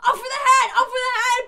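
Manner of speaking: high, loud laughing command; Queen of Hearts. Retold or imitated. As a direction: bellow it like the Queen. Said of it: "every line she said was".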